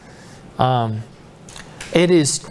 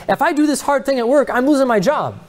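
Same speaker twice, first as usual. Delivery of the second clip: worried objection; 8 semitones higher than usual. Acted. Voicing a worried believer's reply.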